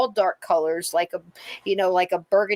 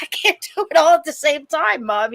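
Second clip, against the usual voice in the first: higher, louder, overwhelmed protest; exasperated kid; 8 semitones higher, 6 dB louder than usual. Retold or imitated. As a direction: whine it back at mom, frazzled. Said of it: "and I'm like".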